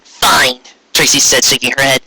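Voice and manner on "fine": ordinary delivery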